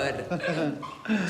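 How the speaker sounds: high put-on laugh. Aunt laughing.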